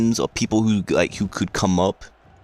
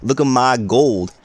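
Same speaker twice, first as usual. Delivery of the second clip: cocky brag; 4 semitones higher than usual. Retold or imitated. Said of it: "Chad's like".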